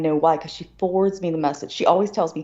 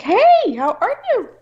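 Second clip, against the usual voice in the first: much higher, louder, sugary imitation of the aunt; mock sweet aunt; 6 dB louder, slower than usual. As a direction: mimic her fake-cheery greeting. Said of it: "She's like".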